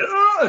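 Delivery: sharp, high gasp of effort; strained gasp. Retold or imitated. Player showing how breathless 3x3 basketball gets, high strained gasp. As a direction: cry out like you're drowning in the game.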